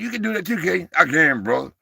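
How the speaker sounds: usual speaking voice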